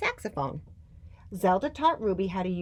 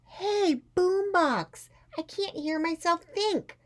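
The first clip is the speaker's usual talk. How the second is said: high, raised-voice shout at a neighbour; shouted complaint; 13 semitones higher, slower than usual. A narrator's reading. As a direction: shout to be heard downstairs. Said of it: "The student on the middle floor shouted".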